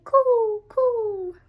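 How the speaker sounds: high, loud bird coos; cooing birds. Acted. Parent reading, cooing like the birds. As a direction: coo like a pair of birds.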